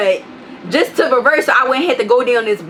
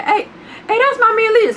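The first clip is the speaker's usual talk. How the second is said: imitating the man; 12 semitones higher than usual. Neighbor calling out to friend Legion, high mock greeting. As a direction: mimic a stranger's cheerful greeting. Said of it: "He said".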